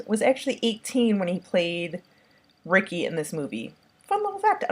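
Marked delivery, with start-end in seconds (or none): 4.0-4.6 fun little fact repeated much higher, playful; squeaky repeat. Shown as a demonstration. Vlogger teasing viewers with trivia, squeaky playful repeat. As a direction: echo the aside in a squeaky sing-song.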